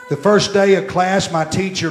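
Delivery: normal talking voice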